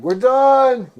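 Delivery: loud, high sign-off; loud announcing. Real, not acted. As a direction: shout the sign-off. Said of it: "All right".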